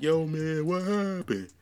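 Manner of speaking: high, mocking voice of a gym friend; mocking gym bro. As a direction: squeak it out like a nosy friend teasing.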